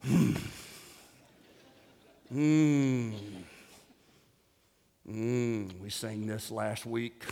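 low, quiet, slow grumble; mock unimpressed churchgoer. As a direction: hum and grumble with no enthusiasm.

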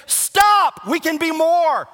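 loud, high preaching shout; shouted command. Real, not acted. Pastor ordering complacent members to stop, shouting. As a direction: thunder it from the pulpit.

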